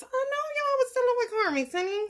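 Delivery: high squeaky teasing voice. Pretend. Teasing viewers about dealing with Carmex.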